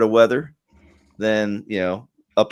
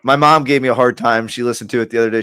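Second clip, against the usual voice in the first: normal talking voice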